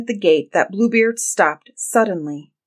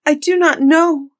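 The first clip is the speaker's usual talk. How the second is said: high, louder cry of denial; distressed cry; 9 semitones higher, 6 dB louder than usual. A narrator's reading. Frightened wife answering her husband about the key, distressed cry. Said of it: "cried the poor woman".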